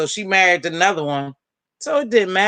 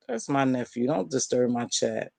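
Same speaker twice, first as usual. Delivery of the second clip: much lower and softer, under her breath; muttered aside; 8 semitones lower, 10 dB quieter than usual. Real, not acted. Repeating to herself about her nephew, low muttered aside. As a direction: mutter it to yourself.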